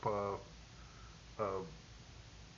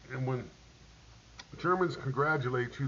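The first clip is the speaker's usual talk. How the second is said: usual speaking voice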